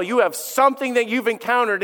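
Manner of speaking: ordinary talk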